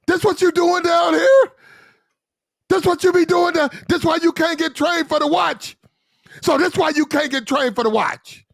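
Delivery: high, strained officer yelling down; mock yelling officer. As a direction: play the outraged lieutenant chewing out a sailor. Lieutenant yelling, voiced high.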